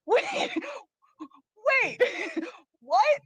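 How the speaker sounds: high-pitched, halting double take; baffled disbelief. Retold or imitated. Host recalls hearing the brother's confession, baffled disbelief. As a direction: let each word jump up high in stunned confusion. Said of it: "And I was like".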